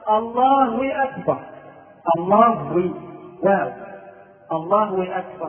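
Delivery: a wa slipped between الله and أكبر; inserted waw. Shown as a demonstration. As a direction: say Allaahu-wakbar, joining أكبر with a w. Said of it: "يدخل واو الإشراك".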